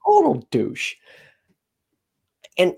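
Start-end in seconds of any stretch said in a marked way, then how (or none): 0.0-1.0 disgusted emphasis. Real, not acted. Venting about friend's ex-husband.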